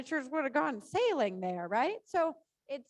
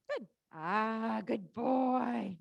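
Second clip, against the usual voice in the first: praising horse; 2 semitones lower, much slower than usual. Real, not acted.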